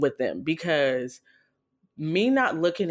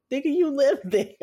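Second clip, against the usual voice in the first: high, louder disbelieving cry; incredulous outburst; 10 semitones higher, 5 dB louder than usual. Retold or imitated. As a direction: blurt it out in shocked disbelief.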